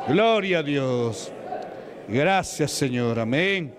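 impassioned thanks to God, slowing toward the end; fervent praise. Real, not acted. Preacher thanking God mid-exorcism, fervent praise. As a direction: cry out praise, then draw the words out.

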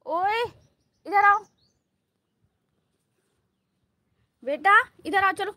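high, loud summoning shout; calling out. Real, not acted. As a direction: call a pet over from a distance. Calling the pet over. (ज़ोर से ऊँची आवाज़ में बुलाते हुए)

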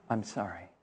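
telling a former schoolmate there is nothing to do, hushed; barely audible sorry. Retold or imitated. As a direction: breathe it out, almost silent. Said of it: "And what will you tell them?".

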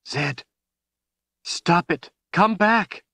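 high, urgent cry building in pitch; panicked plea. A narrator's reading. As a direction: plead desperately for him to come back. Woman shaking unresponsive friend.

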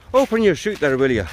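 high-pitched, put-on Irish lilt; terrible Irish accent. Murphy the instructor telling Paddy what to do, hammed-up Irish accent. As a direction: instruct in a bad Irish brogue. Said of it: "apologies for the terrible accent".

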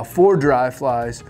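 ordinary delivery

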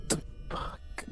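breathless whispered curse; whispered shock. Real, not acted. Gamer reacting to a creepy scene, stunned whisper. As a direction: breathe it out in disbelief.

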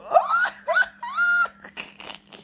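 very high squealing laugh; real shrieking laughing fit; uncontrolled laughter. Real, not acted. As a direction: dissolve into high-pitched giggles.

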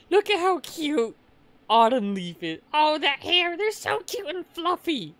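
very high, loud gushing squeal; squealing over cuteness. Real, not acted. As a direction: coo over something adorable. Gushing over a cute character.

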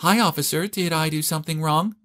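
high, put-on coquettish voice; sweetly flirty high voice; flirtatious. A narrator's reading. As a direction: play innocent with the officer, pitched high. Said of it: "began to speak in a weird way".

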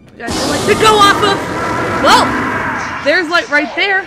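loud, high and urgent; frantic shouting. Real, not acted. Gamer yelling at Emily to run for the light, panicked shout. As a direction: yell the group toward the light.

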